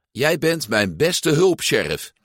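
the cowboy doll praising his helper; sheriff voice. Acted. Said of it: "antwoordde Woody".

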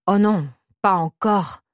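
a mildly exasperated, sighing groan; understated exasperation at his own mistake. Acted. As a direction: sigh it out, fed up with himself. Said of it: "soupire l'homme d'un ton exaspéré".

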